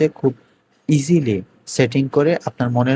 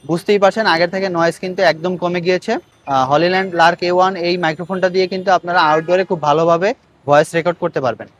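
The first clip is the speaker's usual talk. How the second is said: noise reduction artifacts; 2 semitones higher, 7 dB louder than usual. Real, not acted. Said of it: "noise reduction level থ্রিতে রয়েছে".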